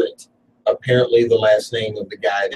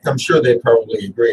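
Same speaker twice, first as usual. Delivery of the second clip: ordinary delivery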